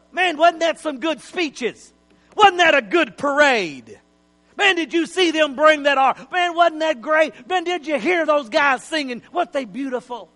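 a hint of a gushing worshiper; chatty churchgoer. Leaving churchgoers praising the service, light mock gush. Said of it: "they'd have said".

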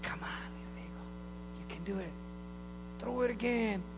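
breathy whisper that rises at the end; whispered coaxing. Retold or imitated. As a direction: coax the eagle in a hushed voice. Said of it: "it's like".

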